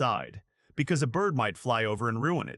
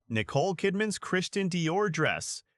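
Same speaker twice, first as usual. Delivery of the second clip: usual speaking voice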